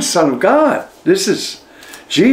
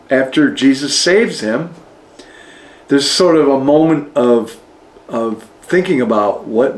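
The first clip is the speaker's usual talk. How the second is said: ordinary delivery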